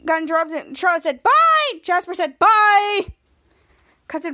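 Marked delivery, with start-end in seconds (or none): 1.2-1.8 high, loud imitated farewell; called-out goodbye. Charlotte heading off to the air shaft, high called-out goodbye. Calling goodbye when leaving in retold scene. 2.4-3.1 loud, raised-pitch farewell; called-out goodbye. Retold or imitated. Jasper leaving after Charlotte, loud called-out goodbye.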